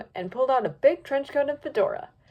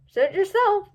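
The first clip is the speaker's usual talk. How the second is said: Misty's voice; 9 semitones higher, 6 dB louder, slower than usual. A narrator's reading.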